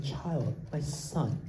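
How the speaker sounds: put-on soft and loving, like calling a child; tender, endearing. Shown as a demonstration. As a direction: say it gently as to a dear son. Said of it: "very warm word".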